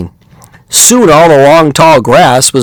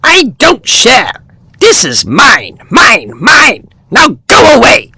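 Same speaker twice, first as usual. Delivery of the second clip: shouting; 10 semitones higher than usual. Acted. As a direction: squawk mine, mine like a greedy bird. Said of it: "shouted Oxpecker".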